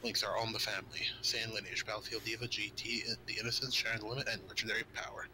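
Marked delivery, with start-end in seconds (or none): none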